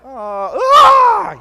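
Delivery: sudden loud yelp of horror, rising high; horrified cry. Retold or imitated. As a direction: cry out in shock. Mimics horrified reaction.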